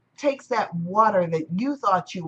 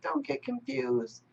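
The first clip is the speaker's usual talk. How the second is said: calm wave; 5 semitones higher, 8 dB quieter than usual. Acted. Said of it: "he was like".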